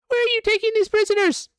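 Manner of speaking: very high, loud squeaky orc voice; orc guard. Acted. GM voices the orc guard questioning the disguised party, high squeaky demand. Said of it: "and says".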